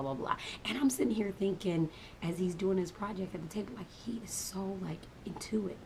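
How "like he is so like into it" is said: hushed, conspiratorial whisper; breathy confiding whisper; whispered aside. Real, not acted. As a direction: drop to a whisper as if he might hear. Telling a secret about son.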